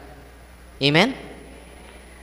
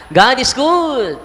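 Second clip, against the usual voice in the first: proclaiming; 7 semitones higher, 7 dB louder, much slower than usual. Real, not acted. Preacher proclaims to congregation.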